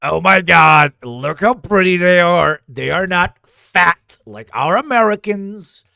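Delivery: lightly mimicked gushing leader; admiring politician character. Leader admiring how pretty they are, lightly mimicked gushing. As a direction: gush with emphatic mock admiration.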